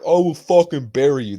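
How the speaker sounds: high, loud mock threat; fighter threat. Fighter threatening rival before sitting down, loud high threat. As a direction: snarl it like a trash-talking fighter. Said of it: "And he's like".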